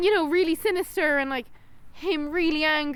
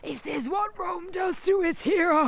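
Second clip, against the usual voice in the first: slow, high, booming hero's call to the crowd; mock gladiator speech; 5 semitones higher, slower than usual. Quoting the hero addressing the crowd, slow ringing shout. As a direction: play the gladiator rousing the arena. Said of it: "he goes to the crowd".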